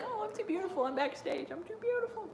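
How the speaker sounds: airy high mock-vain voice, pitched well up; mock-prissy "too beautiful" whine; vain diva. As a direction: ham up a self-adoring starlet. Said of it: "She's like".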